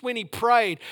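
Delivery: normal talking voice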